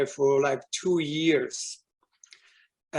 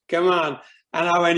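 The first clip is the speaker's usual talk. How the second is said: ordinary talk, nothing marked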